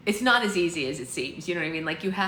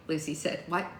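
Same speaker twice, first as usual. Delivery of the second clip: plain reading voice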